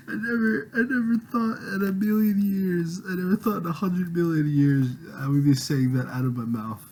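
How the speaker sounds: high, teary voice coming down from a laughing fit; laughter-strained disbelief. Real, not acted. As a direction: struggle for composure after laughing hard. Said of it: "I don't know why I'm laughing".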